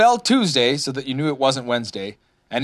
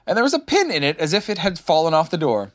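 ordinary delivery

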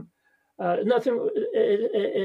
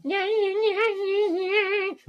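high, silly yeah yeah; mock a goofy guy saying yeah, yeah; hammed-up goofy yeah-yeahs pitched way up; goofy dude impression; 11 semitones higher, much slower than usual. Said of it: "a guy going".

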